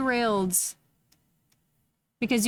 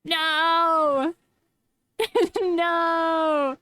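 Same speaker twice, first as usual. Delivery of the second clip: mock anguished no; 5 semitones higher than usual. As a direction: cry out in fake despair. Dreading the cringe redeem.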